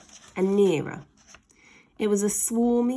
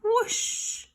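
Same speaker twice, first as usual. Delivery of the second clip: high breathy whoosh; whoosh effect. A narrator's reading. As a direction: whoosh through the air. Flying sound effect.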